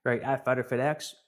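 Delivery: ordinary delivery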